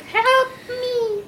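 high, crying plea from below; desperate cry for help. Retold or imitated. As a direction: wail it like a trapped man. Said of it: "and he's like".

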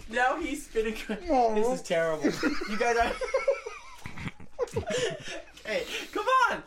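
giggling live commentary, pitch high; laughing. Real, not acted. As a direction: commentate while cracking up. Host commentates, laughing throughout.

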